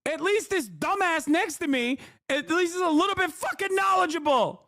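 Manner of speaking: high-pitched comic exasperation; incredulous, squeaky-high put-down; mock indignation. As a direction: keep ranting in a hammed-up high voice. Replaying his indignation at the panelist.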